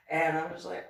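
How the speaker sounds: normal talking voice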